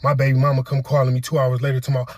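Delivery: usual speaking voice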